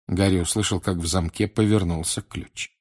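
plain reading voice

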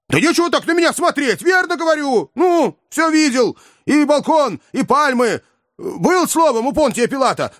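high, excited insistent voice; agitated Ivan. A narrator's reading. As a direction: pitch up, insist hard.

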